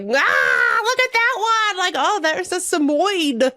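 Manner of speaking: high-pitched, thrilled exclamation; gleeful high outburst; excited squeal. Retold or imitated. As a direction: squeal it as you spot something thrilling. Excitedly pointing things out to companions.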